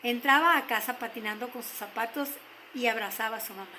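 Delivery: usual speaking voice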